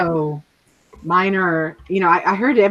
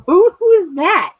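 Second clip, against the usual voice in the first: high, louder mock-baffled question; acted startled disbelief; 12 semitones higher, 6 dB louder than usual. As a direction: act taken aback at your own recorded voice. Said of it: "hear it play back to me".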